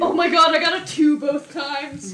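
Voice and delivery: high, loud outburst at bad luck; exasperated disbelief. Real, not acted. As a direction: cry out in disbelief, dragging the end. Disbelief at bad dice rolls.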